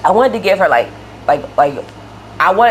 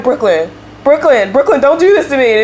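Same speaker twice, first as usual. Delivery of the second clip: high, louder plea to a dog; retold pleading; 8 semitones higher, 4 dB louder than usual. Owner begs the dog on the floor not to do this, pleading. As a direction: plead with the dog in a raised pitch.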